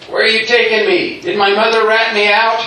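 loud, high, startled questions; alarmed questioning. Retold or imitated. Jose wakes in the ambulance, loud alarmed questioning. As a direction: blurt it out in mock panic.

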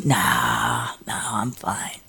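whispered, low and faint denial. Retold or imitated. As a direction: brush it off in a hushed breath. Downplaying pain to brother.

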